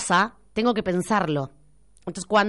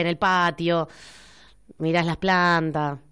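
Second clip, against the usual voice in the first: ordinary talk, nothing marked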